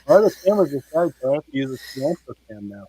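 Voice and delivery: muffled, like talking underwater; bad connection, muffled and warbling; underwater sound. Real, not acted. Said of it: "you sound like you're underwater".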